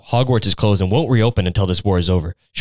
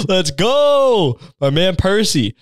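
cheering; 9 semitones higher, much slower than usual. Real, not acted. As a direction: cheer him on like a sports fan. Cheering Percy on.

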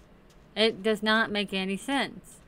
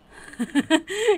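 giggle; 10 semitones higher, 6 dB louder than usual. Real, not acted. Laughs at villager's reaction.

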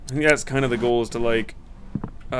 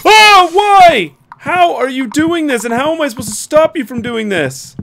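high, loud frightened pleading; panicked wail; 12 semitones higher, 10 dB louder than usual. Real, not acted. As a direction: cry out in real panic. Panicked by monsters.

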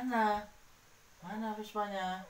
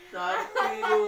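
excited praise; 12 semitones higher, 8 dB louder than usual. Real, not acted. Excited answer.

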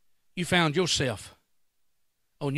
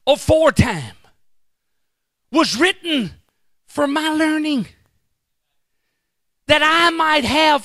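preaching scripture; 12 semitones higher, 11 dB louder than usual. Acted. Preacher proclaiming scripture to the church, loud and high. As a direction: proclaim the verse to the congregation.

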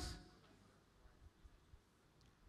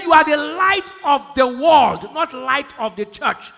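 preaching shout; 4 semitones higher, 9 dB louder, slower than usual. Acted. Preacher quoting scripture at full force.

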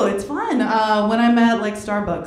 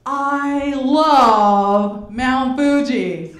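slow mock-foreign phrase; 3 dB louder, much slower than usual. Friend pretending to the guys she speaks no English, very slow. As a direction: stretch each word out slowly. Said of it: "it's like".

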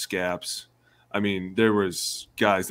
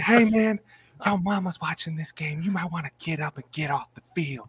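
higher, put-on trainer voice; trainer's warning; 8 semitones higher than usual. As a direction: coax him up, higher. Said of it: "He's like".

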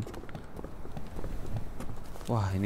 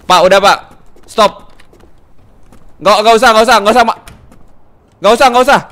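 loud, high urgent shouts; frantic repeated warning, pitched up; shouted warning; 7 semitones higher, 13 dB louder, faster than usual. Pretend. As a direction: yell at a guest to stop right there. Shouting at guest to stop.

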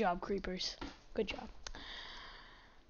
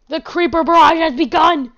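loud, raised mock-epic proclamation; dramatic battle announcement; 4 semitones higher, 10 dB louder than usual. Gamer announces the creeper attack, loud mock-epic cry. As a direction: proclaim it loudly as if war has started.

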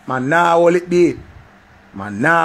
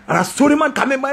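ordinary delivery

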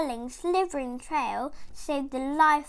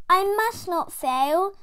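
determined snail; 5 semitones higher, 5 dB louder than usual. Acted. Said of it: "said the tiny snail".

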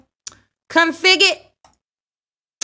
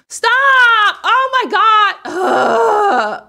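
slow, loud high wail of dismay; real frustrated shriek; loud cry; 11 semitones higher, 7 dB louder, much slower than usual. Real, not acted. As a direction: drag it out in a shout. Glitch on screen.

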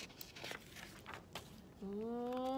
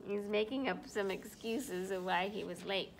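ordinary delivery, nothing marked

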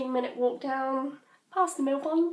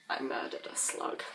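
whisper; 7 dB quieter than usual. Acted. Dark joke after burying story.

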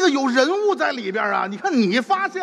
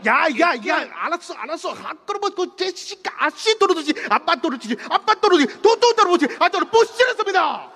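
mock Korean rant; 5 semitones higher than usual.

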